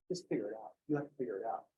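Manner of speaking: dismissive teacher. Retold or imitated. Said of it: "I'm like".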